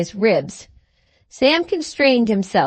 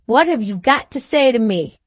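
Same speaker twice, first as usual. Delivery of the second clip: ordinary delivery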